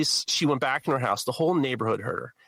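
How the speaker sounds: ordinary talk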